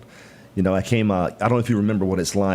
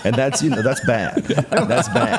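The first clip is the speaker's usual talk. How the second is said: chuckling through the words, a bit higher and louder; laughing; 6 semitones higher, 3 dB louder, slower than usual. Real, not acted. Laughing as he admits he's a Dallas Cowboys fan. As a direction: laugh it off as a confession of sin.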